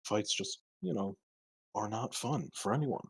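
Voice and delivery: soft, distant ghostly voice; voice from the ether. Acted. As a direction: call faintly from far away. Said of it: "a voice from the ether".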